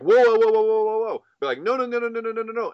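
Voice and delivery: high, loud whoa then no no; high, loud objection; exclaiming whoa. Retold or imitated. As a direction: stop them hard, way up high. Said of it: "replicating the conversation".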